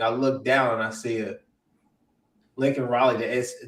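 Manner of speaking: ordinary delivery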